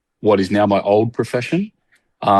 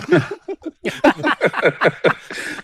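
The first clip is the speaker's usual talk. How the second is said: long, high fit of giggles; high-pitched laugh; 14 semitones higher than usual. Real, not acted. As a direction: laugh helplessly at your own joke. Laughing at own silly voice.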